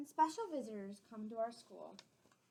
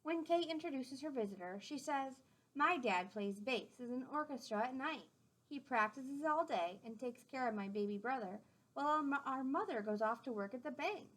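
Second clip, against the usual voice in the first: gentle storybook read-aloud; clear, friendly storytime voice; reading to children; 2 semitones higher, 3 dB louder than usual. A narrator's reading. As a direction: read it out to the class like a picture book. Reading a picture book aloud.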